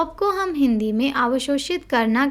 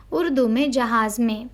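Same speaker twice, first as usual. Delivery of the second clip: normal talking voice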